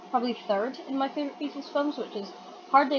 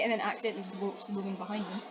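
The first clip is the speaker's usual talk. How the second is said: ordinary delivery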